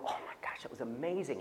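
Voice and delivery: hushed whisper full of wonder; amazed whisper. Retold or imitated. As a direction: lean in and whisper it like a secret miracle. Early church retelling miracles.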